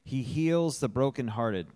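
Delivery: "heals" stands out firmly from the rest; stress on "heals". Real, not acted. Church member reading a psalm aloud, stressing "heals". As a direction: punch the word "heals". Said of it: "emphasis on heals".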